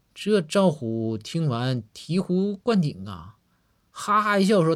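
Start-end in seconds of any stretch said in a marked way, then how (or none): none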